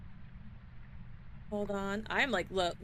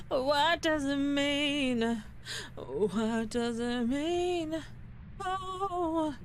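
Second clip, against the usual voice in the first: dazed panic; 3 semitones higher, much slower than usual. Real, not acted. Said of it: "full-blown panic".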